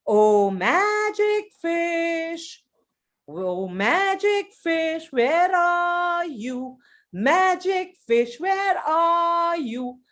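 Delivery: slow, high, drawn-out call across the lake; a fisherman calling out slowly over the water; calling the magic fish. Acted. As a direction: stretch each word out and call it up high. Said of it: "he says".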